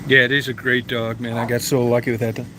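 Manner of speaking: ordinary talk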